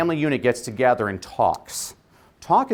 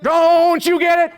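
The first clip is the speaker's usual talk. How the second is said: very high, slow, booming oratory; boy preacher at full force; 8 dB louder, slower than usual. Retold or imitated. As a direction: draw it out loudly like a child preacher rousing a crowd. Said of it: "Howard spoke like Martin Luther King Junior".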